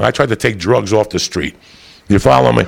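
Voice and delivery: normal talking voice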